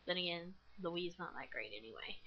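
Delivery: unvoiced whispered aside. Real, not acted. Gamer whispering a jab at the Wii. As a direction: mutter it under your breath.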